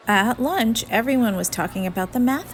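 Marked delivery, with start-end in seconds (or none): none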